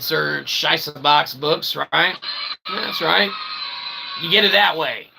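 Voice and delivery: ordinary talk, nothing marked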